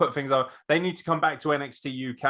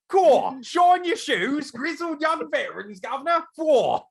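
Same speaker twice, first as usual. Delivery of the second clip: mock British accent; 14 semitones higher, 5 dB louder than usual. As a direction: ham up a loud stock British voice. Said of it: "Your British accent is always the same".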